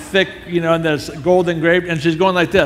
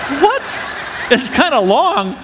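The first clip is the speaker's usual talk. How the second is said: high-pitched, drawn-out disbelief; incredulous echo; 10 semitones higher, slower than usual. Acted. Speaker balking at his friend's lame excuse, incredulous. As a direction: echo it back in mock outrage.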